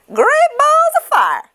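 very high, loud, slow goose cry; very high shocked goose exclamation. Acted. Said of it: "she cried".

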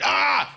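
short high startled yell; imitated cry of alarm; mock scream. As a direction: let out a quick loud "ah".

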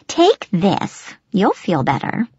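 put-on swooping croak of an old nurse; gravelly old nurse. A narrator's reading. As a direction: urge the cup on the student in a gravelly voice. Nurse's character voice, pressing the cup.

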